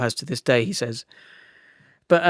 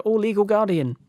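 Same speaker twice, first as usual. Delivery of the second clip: high-pitched mock little kid; child's sing-out in a much higher pitch; four-year-old child; 8 semitones higher than usual. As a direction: call out like a small child. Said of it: "if you're a four-year-old".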